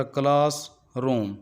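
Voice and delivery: Class रूम in two separated beats; teacher dividing class रूम into two syllables. Shown as a demonstration. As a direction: pause between class and रूम. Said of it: "ये दो syllable पर मुश्तमिल है".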